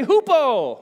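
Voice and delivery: loud, high call to repeat; drawn-out, high teaching shout; with gusto. Shown as a demonstration. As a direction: belt out hupo for the crowd to echo. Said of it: "say it with some gusto".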